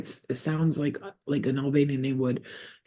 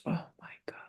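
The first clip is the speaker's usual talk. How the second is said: breathless whisper, overcome; whispered oh my God; 14 dB quieter, much faster than usual. Real, not acted. Psychic overcome while reading for a viewer, whispering. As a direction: let it slip out as a stunned whisper.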